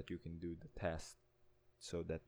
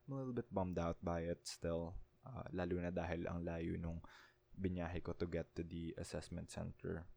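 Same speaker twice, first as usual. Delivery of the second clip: ordinary talk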